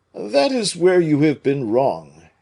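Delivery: aggrieved musketeer; Porthos voice. A narrator's reading.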